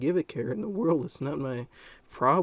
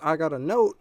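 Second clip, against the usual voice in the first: put-on loud, raised boy voice; imitated kid; 5 semitones higher, 8 dB louder than usual. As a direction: mimic a kid piping up loudly. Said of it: "he goes".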